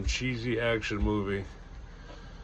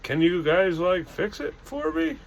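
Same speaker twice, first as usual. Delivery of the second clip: high, rising plea; high-pitched voice; 7 semitones higher than usual. A narrator's reading. Sasquatch asking campers to fix it, high-pitched voice. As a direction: ask for a favor in a raised, squeaky voice. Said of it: "in a surprisingly high-pitched voice".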